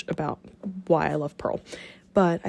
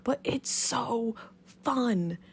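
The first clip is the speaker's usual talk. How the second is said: normal talking voice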